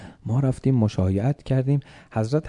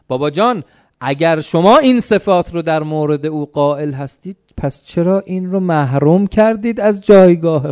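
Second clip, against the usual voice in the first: raised, loud indignant questioning; challenging protest; 6 semitones higher, 9 dB louder than usual. Acted. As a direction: press your father with a loud challenge. Acted son challenges his father. (اعتراض بلند و پرسشگرانه به پدر)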